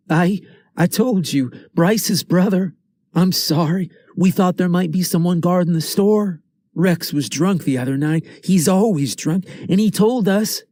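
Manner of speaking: put-on a caught kid blurting out excuses; frightened kid. A narrator's reading.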